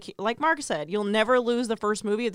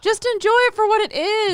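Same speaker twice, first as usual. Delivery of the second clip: higher and louder, pleading; exasperated pleading; 12 semitones higher, 7 dB louder than usual. Real, not acted. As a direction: beg them to lighten up. Arguing the sequel's merits.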